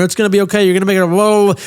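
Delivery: frantic calming, building to whoa; panicked reassurance. Retold or imitated. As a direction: reassure her while panicking inside. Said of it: "So I start panicking".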